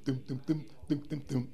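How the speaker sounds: quiet plodding bass dums; soft bass imitation. Shown as a demonstration. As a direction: mumble the old bass line softly. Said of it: "instead of dum".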